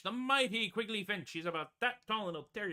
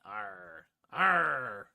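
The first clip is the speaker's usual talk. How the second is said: long drawn-out pirate growl; pirate "arr"; 9 semitones lower, 7 dB louder than usual. Pretend. Showing off for pirate day, piratical growl. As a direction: growl a playful pirate arr, swelling louder.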